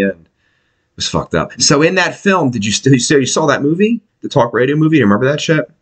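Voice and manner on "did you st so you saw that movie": ordinary talk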